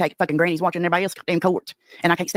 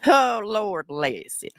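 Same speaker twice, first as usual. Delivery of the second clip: very slow, higher-pitched sigh of words; drawn-out mock pity; 4 semitones higher, much slower than usual. Real, not acted. Streamer reacting to the rival's upset, drawn-out pity. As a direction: stretch every word out long.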